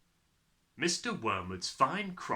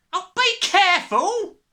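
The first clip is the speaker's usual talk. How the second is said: slow, high, loud shriek; 13 dB louder than usual. A narrator's reading. Mother sees powder spilling, shrieking. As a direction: shriek the warning, stretching it. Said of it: "shrieked the mother".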